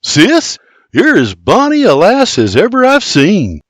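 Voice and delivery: lightly voiced young Scots man; shy young farmhand. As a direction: compliment her awkwardly. Clears throat, shy compliment.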